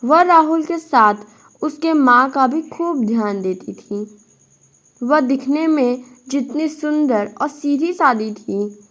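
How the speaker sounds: plain reading voice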